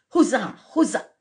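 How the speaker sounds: high, loud cheer; cried out. A narrator's reading. Court cheering the golden fireworks, cried out in delight. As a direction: shout it like a cheering crowd. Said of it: "cried the court".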